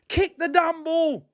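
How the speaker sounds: high, slow frustrated command; real fan frustration at the keeper; exasperated yell. Real, not acted. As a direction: shout it at the screen. Yelling at keeper.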